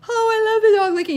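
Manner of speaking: put-on high, loud gushing kid; high excited child voice. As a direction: squeal it like an excited boy. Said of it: "is like".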